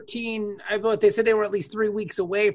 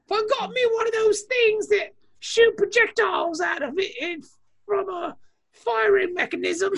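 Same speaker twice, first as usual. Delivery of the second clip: high, quick put-on British voice; a detective musing about a pistol; mock British; 11 semitones higher than usual. Joking as the gun-less detective, high mock British. Imitating Luther wanting a gun.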